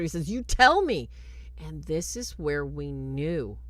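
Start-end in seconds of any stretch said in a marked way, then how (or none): 0.3-1.1 loud, high commanding demand; yelled command. Retold or imitated. Priest yells at the possessed girl, loud and high. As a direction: snap it back at her. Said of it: "he yells at her".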